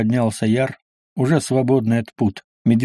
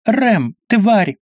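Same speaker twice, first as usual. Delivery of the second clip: high-pitched, furious bark; angry bark; 9 semitones higher, 4 dB louder than usual. A narrator's reading. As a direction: bark the name and insult angrily. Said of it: "рявкнул Ифрит". (яростный высокий рявк)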